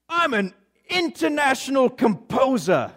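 high, exaggerated put-on accent; Charles Siegel. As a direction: proclaim it grandly in a butchered accent. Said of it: "got this incredible accent".

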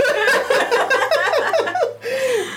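high-pitched giggle; high laugh. Real, not acted. Laughs at own story.

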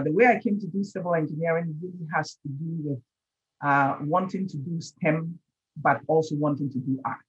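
usual speaking voice

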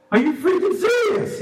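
high-pitched, incredulous acted outburst; ticked-off Jesus. Preacher voices Jesus at the silent Pharisees, ticked off. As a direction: throw it out in exasperated disbelief. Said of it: "Jesus got ticked off".

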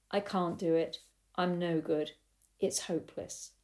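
soft, defeated self-talk; subdued, giving-up voice, fading out; despair. Retold or imitated. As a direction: let it sink away into hopelessness. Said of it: "the grumpiness or the despair".

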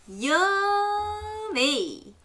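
high, long-drawn-out relish; swooping high, then falling at the end; savouring. Shown as a demonstration. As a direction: stretch the word way out with delight. Demonstrates word for children to repeat.

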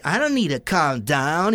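slow, drawn-out mocking imitation; mocking defiant girl. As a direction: mimic her stubborn refusal, stretching the words. Mimics the girl refusing to calm.

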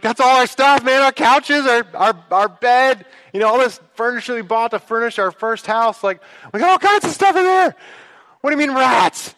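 high, loud panicked rant; freaking out. Retold or imitated. Pastor panics to the custodian about his furniture. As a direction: spill the panic about losing everything. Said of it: "He's, like, freaking out".

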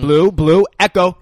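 loud, high mock trainer call; calling out commands. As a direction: shout names at the pack, imitating. Imitating trainer calling raptors.

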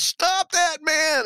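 high-pitched, drawn-out yell; yelled command. Retold or imitated. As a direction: cry out the alarm, stretching each word. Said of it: "she pauses and yells".

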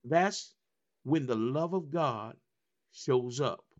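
normal talking voice

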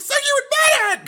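extremely high mock-hysterical screech; Jade losing it. Jade pouncing on Jesse's slip, hysterical and high. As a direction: screech it at the top of your range. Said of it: "'Cause she's losing her shit".

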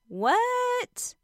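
a long, high, stretched what; shocked what. Real, not acted. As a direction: stop her short in disbelief. Catching a bad thought.

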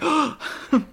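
imitated sharp gasp; play-acted gasp of shock; mock gasp. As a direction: gasp as the astonished guy in the story. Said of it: "and the guy's like".

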